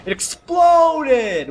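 high, loud, drawn-out exclamation; shocked shout. Real, not acted. Player reacting to a blast in game, shocked high shout. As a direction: yell it in slow-stretched disbelief.

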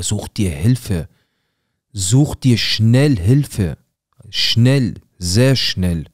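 loud, slow, stretched yell to get help; furious shout. Retold or imitated. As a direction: hammer each word, slow and loud. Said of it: "Mein Vater dreht durch". (wütend langsam gebrüllt)

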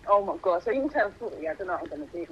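usual speaking voice

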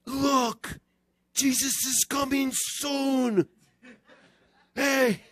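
high, thrilled cry of discovery; breathless high-pitched excitement, acted out; excited stepbrother. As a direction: imitate an overexcited kid spotting a sign. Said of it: "he was excited".

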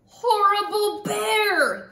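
loud, high, drawn-out cry of outrage; girl's angry shout. A narrator's reading. Girl yelling at the bear who rolled on it, indignant shout. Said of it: "the girl shouted".

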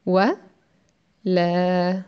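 ولا drawn out slowly, the laa held long; long syllable walaa. Shown as a demonstration. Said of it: "lues en une seule syllabe". (ولا prononcé lentement, le laa allongé)

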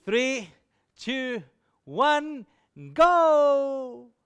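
high countdown building to a loud, long final cry; acting out a triumphant send-off; called-out countdown. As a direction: count down with pauses, then launch it. Acts out wife's countdown.

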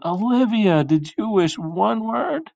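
high-pitched, drawn-out questioning; urgent yell. Acted. Parents running in to question Olivia, high anxious yell. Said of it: "ran in and yelled".

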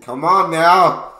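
drawn-out loud slow holler; loud exclamation. Real, not acted. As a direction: holler it out slowly.

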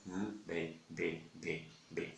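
B held long and soft on the lips; drawn-out quiet B. Shown as a demonstration. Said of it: "la B larga es". (B larga, sostenida)

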